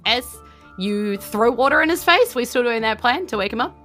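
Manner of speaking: normal talking voice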